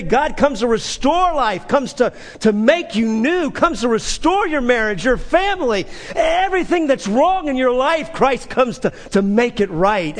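loud, high, exultant proclamation; proclaiming loudly. Shown as a demonstration. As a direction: announce it to the nations with joy. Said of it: "This is what we say to the nations".

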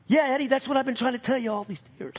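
quick, high-pitched excited dad; exasperated dad. Retold or imitated. Dad butting in on Eddie's testimony, high and hurried. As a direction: blurt it out like an impatient dad. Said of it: "you gotta know my dad".

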